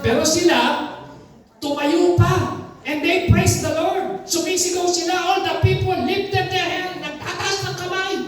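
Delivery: high, ringing sermon voice; fervent preaching. Acted. Pastor telling the church how the people praised, fervent preaching. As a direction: preach it out over the whole hall.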